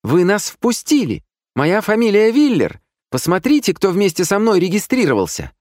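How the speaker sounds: high, loud, hysterical. Retold or imitated. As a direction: shout it in hysterics. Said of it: "Я в истерику".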